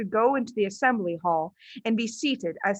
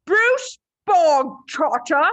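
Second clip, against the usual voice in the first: slow, loud, higher bark of the name; 7 semitones higher, 7 dB louder, much slower than usual. Acted. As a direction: bark the boy's name across the hall. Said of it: "the Trunchbull barked suddenly".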